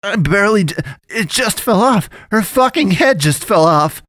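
high, loud frantic cry; panicked yelling. A narrator's reading. Man stumbling from headless body, high panicked yell. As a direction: blurt it in total panic.

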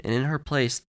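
ordinary delivery, nothing marked

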